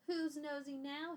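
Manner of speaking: loud, high angry yell. Acted. Boy yelling back at the old man, loud. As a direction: throw the word back at him. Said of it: "he yelled".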